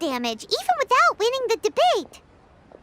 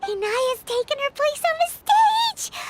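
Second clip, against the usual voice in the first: excited; 6 semitones higher than usual. Real, not acted. Paimon sees Inaya on stage.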